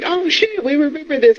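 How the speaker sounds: high excited mock crowd voice. Co-host mimics the crowd cheering the old theme, high and excited. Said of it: "everybody was like".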